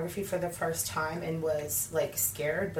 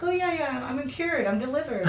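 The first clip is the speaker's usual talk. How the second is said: high, bright fake assurance; fake cheerful reassurance; 9 semitones higher, 5 dB louder than usual. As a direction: sell the lie, pitched up and chirpy. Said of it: "I would lie and be like".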